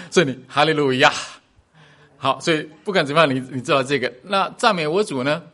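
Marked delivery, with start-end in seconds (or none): 0.5-1.1 哈利路亚 with a rough throat sound on the h; guttural Hebrew 哈利路亚, the 哈 scraped from the back of the throat; throaty hallelujah. Shown as a demonstration. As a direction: clear your throat into the 哈 of 哈利路亚. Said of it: "好像老是要一口痰要吐在你头上一样".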